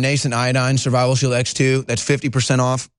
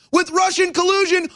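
high, drawn-out exasperated cry; getting mad. Real, not acted. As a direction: build to a strained, high-pitched yell as the temper goes. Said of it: "I'm, I'm getting mad".

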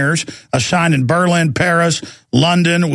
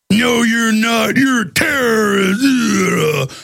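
slow, drawn-out, high mocking voice; dumb accuser; 5 semitones higher, much slower than usual. Dim stranger refusing to believe the actor, mocking dumb-person voice. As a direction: drag it out like a dim-witted stranger. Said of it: "That's how dumb they are".

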